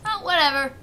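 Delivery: usual speaking voice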